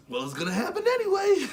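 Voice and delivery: high mock voice of a passer-by; imitating a stranger, high and light; shrugging stranger. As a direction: voice the stranger who doesn't care.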